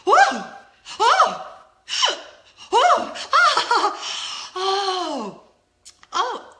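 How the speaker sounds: loud high whoops of awe with laughter; reliving the ride. Retold or imitated. Vlogger reliving the Banshee ride, ecstatic whoops. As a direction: squeal through the thrill like on the ride. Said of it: "and I'm like".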